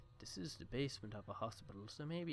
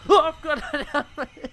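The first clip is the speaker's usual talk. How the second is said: much higher, much louder yelp of glee; real thrilled shriek at the find; excited shout; 23 dB louder than usual. Real, not acted. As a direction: burst out shouting with delight. Finds a helmet in game.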